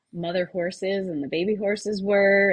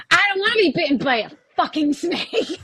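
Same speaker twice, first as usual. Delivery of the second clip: louder, higher, laughing through the curse; laughing defiant outburst; 8 semitones higher, 5 dB louder than usual. Retold or imitated. To the podcast host, a laughing retold outburst. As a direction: laugh while blurting it out. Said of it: "Can I swear on this show?".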